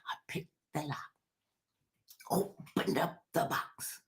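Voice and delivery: hushed confession, unvoiced; whisper. Real, not acted. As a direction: let the guilty secret slip out in a whisper. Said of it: "why did you whisper when you said you picked the lock".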